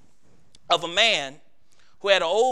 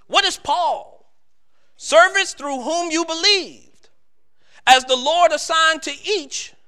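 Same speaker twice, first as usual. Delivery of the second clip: fervent scripture reading; 6 semitones higher, 5 dB louder than usual. Acted. Scripture read with preaching force.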